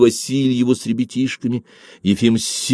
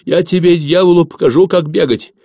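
high, loud angry scolding; raised, menacing outburst; threatening; 9 semitones higher, 5 dB louder than usual. A narrator's reading. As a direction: threaten like a furious mother. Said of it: "угрожающе и тяжело дыша, ответила мать".

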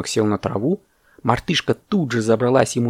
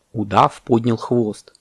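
ordinary delivery, nothing marked

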